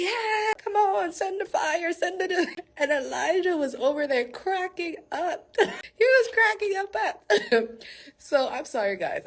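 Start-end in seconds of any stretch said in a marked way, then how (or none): 0.0-2.6 high, pleading chant to their god; hammed-up high begging; mock worshipers. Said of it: "they were there worshiping". 2.8-7.8 real laughter breaking into the words; high, giggly telling through laughter; cracking up. Real, not acted. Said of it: "I'm trying to keep it professional".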